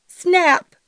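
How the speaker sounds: sudden sharp yell. A narrator's reading. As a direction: yelp it out, startled by cold water. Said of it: "she yelled".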